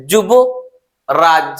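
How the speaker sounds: slow, drawn out syllable by syllable; teacher slowly splitting a name into syllables. Shown as a demonstration. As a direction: break Yuvraj slowly into its syllables. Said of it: "যখন এইভাবে যখন উচ্চারণ করব".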